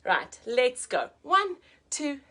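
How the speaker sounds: ordinary delivery, nothing marked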